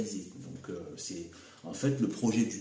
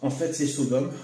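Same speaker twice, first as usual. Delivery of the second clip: usual speaking voice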